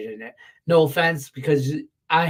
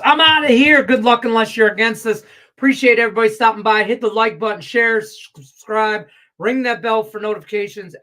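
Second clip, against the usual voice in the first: higher, louder, fast hype outro; loud sign-off; 6 semitones higher, 7 dB louder than usual. Acted. Betting host signing off to his viewers: loud hype outro. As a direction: blast the goodbye like a hype host.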